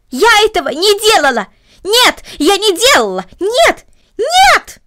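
screaming no in outrage; loud shout. Acted. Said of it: "громко закричала Корнели".

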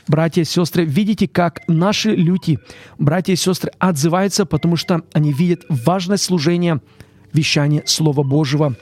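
ordinary talk

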